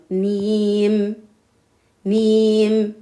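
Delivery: "nim" drawn out very slowly. Shown as a demonstration. Teacher draws "nim" out slowly for pupils. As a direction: stretch every sound of "nim". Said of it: "des accents longs".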